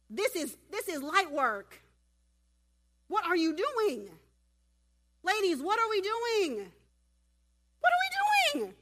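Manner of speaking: hammed-up disbelief, stretched out and rising; frustrated Jesus. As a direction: build each question up to a high yell. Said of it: "He's frustrated at this point".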